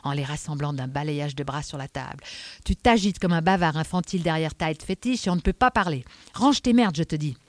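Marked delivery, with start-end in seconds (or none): 2.7-7.4 forceful, irritated inspector; irritated Adamsberg. Acted. Inspector orders the man to clear his clutter, irritated. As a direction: order him about impatiently.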